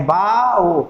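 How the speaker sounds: बा and ओ said as two separate sounds; teacher's split of बायो into बा - ओ; syllable by syllable. Shown as a demonstration. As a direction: hold बा, then a distinct ओ. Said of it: "pronunciation आपको करते रहना है".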